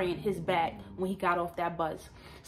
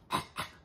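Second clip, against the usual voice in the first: imitated cough; 3 dB quieter than usual. Imitating passengers choking on mace, mock cough. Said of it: "Everybody's choking and coughing".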